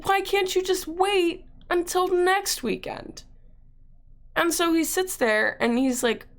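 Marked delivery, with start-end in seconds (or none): 0.0-3.2 high, drawn-out pleading; stretched-out, high-voiced exasperation; retold exasperated plea. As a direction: plead slowly, pitched way up. Retold pleading to protect the surprise.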